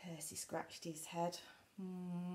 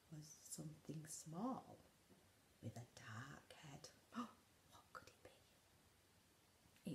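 gasping whisper; 14 dB quieter than usual. A narrator's reading. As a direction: hush as if afraid. Suspenseful reveal.

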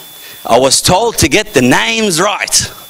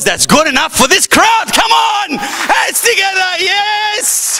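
rousing the crowd; 13 semitones higher, slower than usual. Real, not acted. Host demanding applause from the crowd, rousing shout. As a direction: build to a high-pitched holler.

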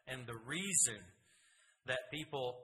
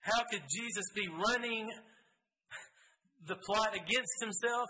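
preaching climax; 7 semitones higher, 4 dB louder than usual. Real, not acted. Rhetorical climax of sermon.

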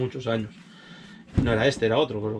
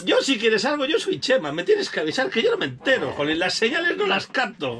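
much higher, goofy mock naive voice; clueless guy voice; 9 semitones higher than usual. Husband mocking himself as a clueless suitor, voice pitched high. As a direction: play the dim suitor. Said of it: "te lo soltaba así".

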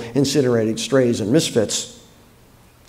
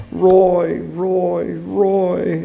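higher, anguished repeated name; wringing the name out; 6 semitones higher, much slower than usual. A narrator's reading. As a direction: wring each word out of the throat in distress. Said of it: "as if ringing it in her throat".

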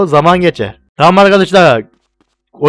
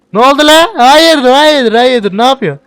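high, louder, puzzled calls; startled outburst; 8 semitones higher, 4 dB louder, slower than usual. Real, not acted. Gamer reacts startled to a rude character. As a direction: react in disbelief. NPC suddenly acting up.

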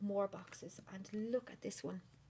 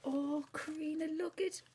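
high, loud gleeful cry; excited squeal; 7 semitones higher, 4 dB louder than usual. Real, not acted. Unwrapping another gift from Karina, squealing. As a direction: squeal at the surprise.

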